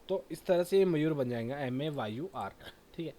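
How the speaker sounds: ordinary talk, nothing marked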